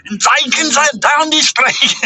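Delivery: joining in the joke with a mock race-caller accent; mock announcer accent. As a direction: ham up a harsh accented race commentary. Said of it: "mock race announcer voice".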